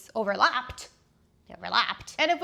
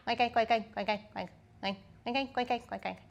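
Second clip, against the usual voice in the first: pig oinking; 5 dB quieter than usual. Pretend. Playful pig noises.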